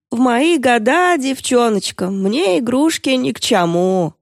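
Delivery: put-on slow lisping old man, lip pushed out. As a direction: creak it out like a toothless grandpa. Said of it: "по-стариковски оттопыривая нижнюю губу".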